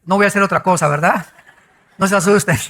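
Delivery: ordinary talk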